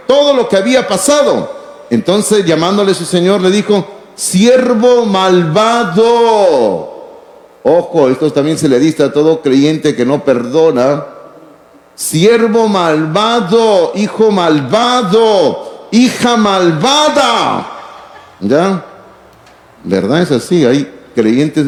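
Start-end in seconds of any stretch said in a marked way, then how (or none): 4.1-6.9 very slow, each word weighed; stern rebuke. Acted. King rebukes the servant. 11.9-17.7 slow, weighty repetition; solemn emphasis. Real, not acted. Preacher applies rebuke to listeners.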